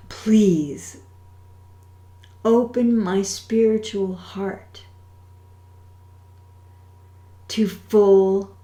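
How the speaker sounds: slow, deliberate, over-enunciated prayer. Shown as a demonstration. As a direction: pray each line slowly, with long pauses. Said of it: "I will say it slowly".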